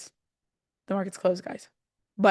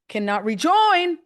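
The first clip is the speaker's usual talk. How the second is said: higher, louder, drawn-out outburst; real worked-up plea, pitched up; excited; 7 semitones higher, 5 dB louder, slower than usual. Real, not acted. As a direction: get carried away, stretching the words. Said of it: "Sorry, I'm getting excited".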